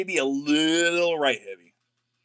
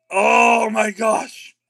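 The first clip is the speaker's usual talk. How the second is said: drawn out in awe, loud; stunned slow gasp; 4 semitones higher, 3 dB louder, much slower than usual. Real, not acted. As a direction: let it stretch out in disbelief. Big card pull reveal.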